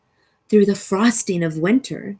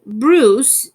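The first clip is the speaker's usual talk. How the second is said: plain reading voice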